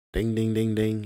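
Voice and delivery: loud sing-song ding ding like a game-show bell; mock alarm bell. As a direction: ring it out like a buzzer for the obvious answer. Mock bell after husband blamed.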